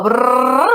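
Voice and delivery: buzzing lips noise; mock nonsense noise; lip trill brrr. Shown as a demonstration. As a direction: blow a brrr through the lips. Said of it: "Si yo les digo".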